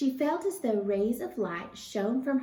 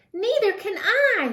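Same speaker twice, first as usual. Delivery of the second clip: much higher, louder wail; howl; 12 semitones higher, 9 dB louder than usual. A narrator's reading. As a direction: howl in panic. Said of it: "howled Flitter".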